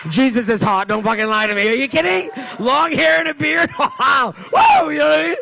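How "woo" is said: loud, very high "woo"; excited whoop. Real, not acted. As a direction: whoop with sudden high-pitched delight. Whooping at how hot Jesus is.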